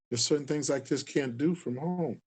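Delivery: usual speaking voice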